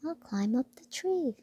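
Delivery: high, small mouse voice; piping little mouse; squeaky mouse voice. Acted. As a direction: voice Mortimer as a tiny eager mouse. Mouse character speaks.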